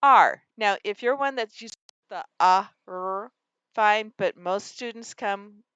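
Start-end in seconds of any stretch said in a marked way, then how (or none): 0.0-0.4 R said as a single loud sound; isolated sound. Shown as a demonstration. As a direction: model the one sound "R" clearly and loud. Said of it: "and the sound is".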